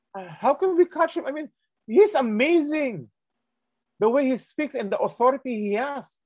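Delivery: high, wondering voice; amazed guards. Retold or imitated. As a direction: marvel at him in disbelief.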